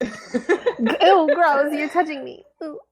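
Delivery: disgusted pet cat, recoiling; put-on cat voice full of disgust; cat's voice. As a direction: voice a cat grossed out by being touched. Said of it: "That's the cat voice".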